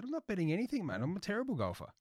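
high, whiny refusal; high-pitched protest. Retold or imitated. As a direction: squeak it out in a high voice. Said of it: "I said".

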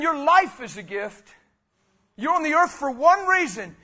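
loud, high, full-force preaching; fiery sermon shouted to the congregation; passionate preaching. Real, not acted. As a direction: preach it at the top of your voice. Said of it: "I know I'm a little passionate right now".

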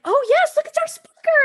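very high, bright announcing voice; mock cheerful welcome. Greeting the late speaker to the room, high mock cheer. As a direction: chirp it sky-high, hamming it up.

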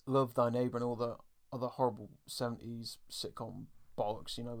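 ordinary talk

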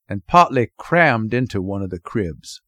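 plain reading voice

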